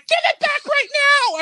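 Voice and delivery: high, loud, drawn-out yell; hammed-up furious scream; screamed demand. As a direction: re-enact a shrieked order, slowly stretched. Retold screamed demand in tug-of-war.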